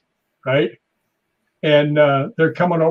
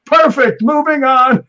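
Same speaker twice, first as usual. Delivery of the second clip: high, loud director's call; imitated triumphant set-wide shout; announcing to a crowd; 10 semitones higher, 7 dB louder, slower than usual. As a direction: holler it out to the whole crew. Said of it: "looks at the crowd".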